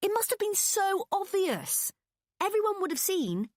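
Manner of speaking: cringe at the memory; embarrassed whine. A narrator's reading. Embarrassed moaning continues.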